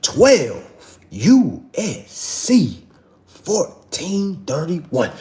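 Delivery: very slow, each part spaced out; pointed slow dictation; slow, for trolls. Shown as a demonstration. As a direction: spell it out for the doubters. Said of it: "One more time for the trollers".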